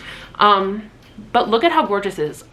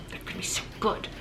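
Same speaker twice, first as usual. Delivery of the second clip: whisper; 13 dB quieter, faster than usual. Real, not acted. Excited about new book series.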